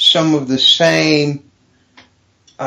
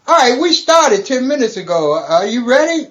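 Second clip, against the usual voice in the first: higher-pitched imitation of the host; mock late caller; 7 semitones higher than usual. Host calling the guest late to go on air, higher mock-casual voice. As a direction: lightly mimic a radio host phoning. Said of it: "call me back ten minutes later and go".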